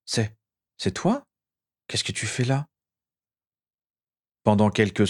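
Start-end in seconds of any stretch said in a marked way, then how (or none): 0.1-2.7 stunned. A narrator's reading. Stunned at seeing his brother.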